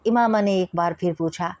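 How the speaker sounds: ordinary delivery, nothing marked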